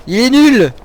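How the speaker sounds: loud, high outburst of annoyance; exasperated shout. Real, not acted. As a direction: cry out at a useless companion. Fed up with erring companion.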